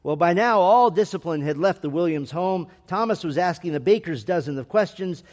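usual speaking voice

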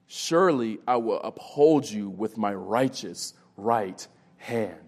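slow, measured Bible reading; scripture reading. Acted. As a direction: read the verse slowly, with weighty pauses. Reads scripture aloud.

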